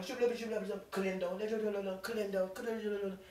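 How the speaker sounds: mock Spanish gibberish chatter; in-laws chattering. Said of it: "they'd be, like, over there talking".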